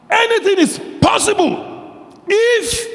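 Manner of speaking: high, slow, shouted challenge; Jesus asking. Acted. Preacher voicing Jesus to the doubting father: shouted challenge. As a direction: throw the word if back at him. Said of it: "Jesus asks".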